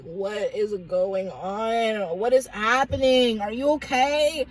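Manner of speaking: low, slow groggy questioning at first; lightly voiced sleepy parents. Exhausted parents asking their child what happened, groggy. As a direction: imitate parents just woken from deep sleep. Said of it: "exhausted, just woke up from a deep sleep".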